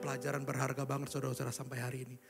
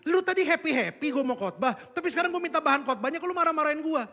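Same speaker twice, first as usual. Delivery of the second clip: loud, higher indignant complaint; mock indignant son; confused protest; 11 semitones higher, 9 dB louder than usual. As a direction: replay the son's baffled protest. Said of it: "saya bingung".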